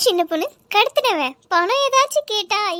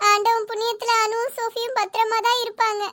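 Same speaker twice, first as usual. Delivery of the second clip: ordinary talk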